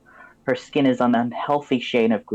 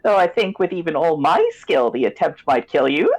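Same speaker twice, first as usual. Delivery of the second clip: witch voice; 6 semitones higher, 3 dB louder than usual. Acted. Crone's threat continues.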